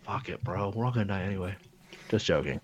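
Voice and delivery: ordinary talk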